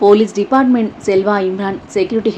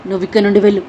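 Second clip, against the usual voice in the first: normal talking voice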